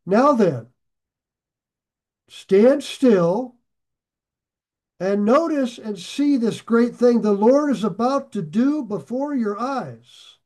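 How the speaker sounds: slow, loud and commanding, every word weighed; booming pulpit reading of a prophet's command; dramatic scripture reading. Acted. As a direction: proclaim it like the prophet calling the people to witness. Second translation read dramatically.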